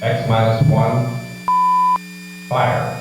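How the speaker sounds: loud, measured launch countdown; countdown announcer. Acted. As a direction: call the countdown like mission control.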